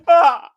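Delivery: high loud groan of relief; exhausted groan. Retold or imitated. Retelling the character walking in, loud groan. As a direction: let out a big collapsing groan. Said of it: "and he goes".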